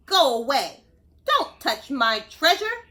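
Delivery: loud grumpy lobster growl. Acted. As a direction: snarl it like a grumpy lobster guarding coins. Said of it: "the lobster growled".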